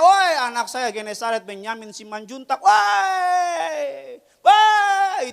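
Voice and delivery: put-on loud, high shouting at the kids; mock yell. Wife yelling at the kids downstairs, loud mock shout. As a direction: holler across the house. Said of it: "Turun ke bawah istri saya".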